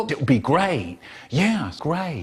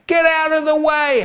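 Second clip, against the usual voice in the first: high yell; 12 semitones higher, 5 dB louder than usual. Acted. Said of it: "Then I said".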